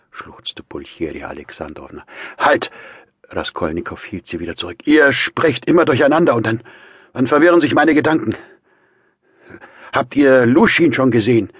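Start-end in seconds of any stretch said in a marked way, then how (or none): 2.4-2.7 high, loud command to stop; sudden raised-voice order; urgent call. A narrator's reading. Holds the women back. 4.9-11.5 high, loud, overwrought man; irritable, raised voice; agitated Raskolnikow. A narrator's reading. Confused, irritated brother.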